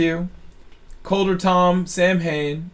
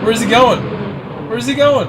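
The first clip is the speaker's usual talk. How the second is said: excited; 5 semitones higher, 4 dB louder than usual. Real, not acted. Watching the drift champion live.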